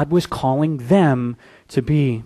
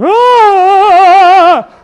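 sudden high yelp of fright; retold scream of terror; terrified scream; 12 dB louder than usual. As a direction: shriek like you've seen a ghost. Disciples see ghost on water.

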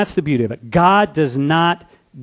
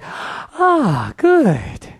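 contented Jonah; 8 semitones higher, much slower than usual. Retold or imitated. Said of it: "Jonah's like".

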